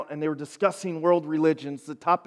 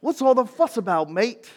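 higher-pitched English accent slipping into Australian; badly faked English accent, comes out Australian; 7 semitones higher, 3 dB louder than usual. Shown as a demonstration. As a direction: try English, land on Australian. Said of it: "it comes out like Australian".

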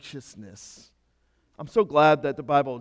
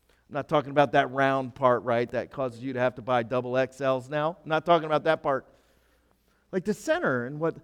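normal talking voice

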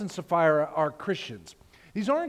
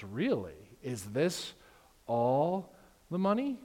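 suspicious; 3 semitones lower than usual. Acted. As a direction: question it slowly, smelling something fishy. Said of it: "He sees something fishy and goes".

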